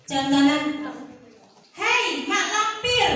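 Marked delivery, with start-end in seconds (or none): 1.8-3.1 high, drawn-out rude call; impolite intonation. Shown as a demonstration. Teacher shows pupils a rude way to call someone, impolite. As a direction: call out rudely, pitched up and stretched. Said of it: "Intonasi yang kurang so-, sopan".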